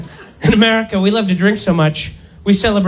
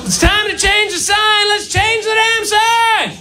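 much higher, shouted, half-sung demand; ranting rally cry; 10 semitones higher than usual. Real, not acted. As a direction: whip the crowd up. Ranting demand to change sign.

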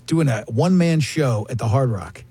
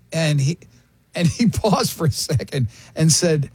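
ordinary talk

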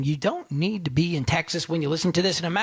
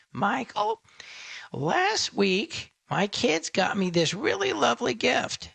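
lightly put-on higher caller voice; a hint of a mom disguising her voice, higher; disguised caller voice; 6 semitones higher, slower than usual. Host mimicking a mom caller hiding her voice, lightly higher. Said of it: "Some of you disguise your voice".

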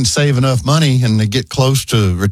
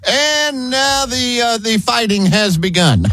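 high, drawn-out announcer voice; stretched-out mock play-by-play, pitched up; radio play-by-play caller; 10 semitones higher, slower than usual. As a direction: imitate a commentator still calling the action. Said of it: "the play-by-play after the game".

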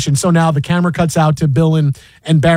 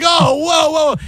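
startled whoa; 11 semitones higher, slower than usual. Retold or imitated. Reacting to the on-air swear.

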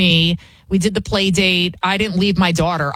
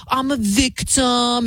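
mock victim whine; 3 semitones higher, slower than usual. Mocking victim talk.

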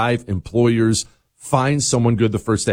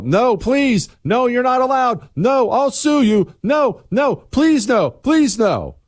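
high, pleading mock whine; anguished denial; 12 semitones higher, slower than usual. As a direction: beg and protest like a panicked victim. Democrats voiced fighting voter roll checks.

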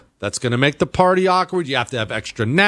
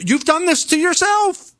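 mock scolding. Host scolds nacho eaters.

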